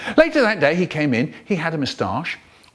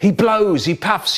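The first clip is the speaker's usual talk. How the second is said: ordinary delivery, nothing marked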